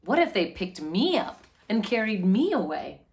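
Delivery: usual speaking voice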